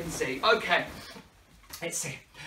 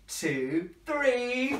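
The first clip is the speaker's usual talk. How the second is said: normal talking voice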